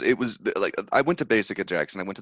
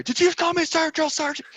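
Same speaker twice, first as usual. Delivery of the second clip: drill sergeant; faster than usual. Retold or imitated. Drill sergeant line quoted.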